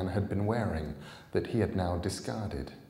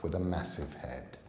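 usual speaking voice

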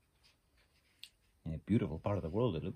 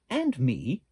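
louder and higher, eager little duck; 5 semitones higher, 6 dB louder than usual. Acted. The duck offers friendship to Little Beaver, louder and higher. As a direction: pipe up brightly, higher and louder.

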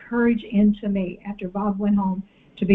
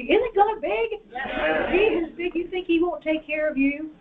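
much higher, exultant cry; excited; 6 semitones higher than usual. Shown as a demonstration. Preacher rouses the congregation, very excited. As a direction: ring it out with joy. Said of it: "Get excited with me".

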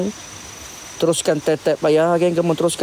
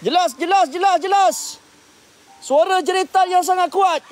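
alarmed excitement; 14 semitones higher than usual. Real, not acted. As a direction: react to a scream in the forest. Reacting to clear scream.

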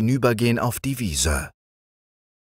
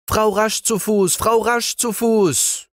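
hoch und gedehnt rufen; laut nach der Spinne rufen; rufende Kinder; 4 dB louder than usual. A narrator's reading. (Kinder rufen die Spinne hoch und gedehnt)

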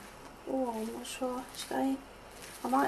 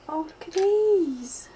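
higher, louder, slow delight; excited; 5 semitones higher, 7 dB louder, slower than usual. Real, not acted. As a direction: swoon over the gift. Delighted by a gift.

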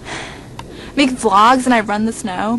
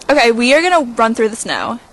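ordinary talk, nothing marked

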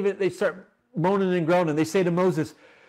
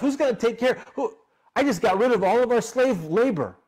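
high, frantic Pharaoh, much higher; panicked Pharaoh; 7 semitones higher than usual. Acted. Pharaoh realising he freed his slaves, high and frantic. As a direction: rattle off each question in rising panic.

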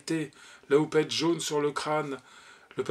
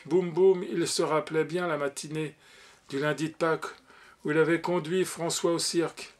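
plain reading voice

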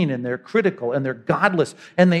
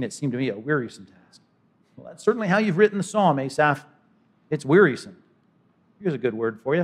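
usual speaking voice

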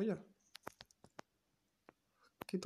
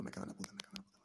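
whispered swearing, almost no voice; cursing under breath; 6 semitones higher, 8 dB quieter, much faster than usual. Real, not acted. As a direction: hiss the curse under your breath. (maldiciendo en voz baja, susurrando)

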